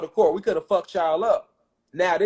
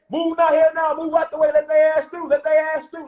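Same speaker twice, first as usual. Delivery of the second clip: aggressive shouting; 7 semitones higher than usual. Retold or imitated. Pushing through to fight Satan.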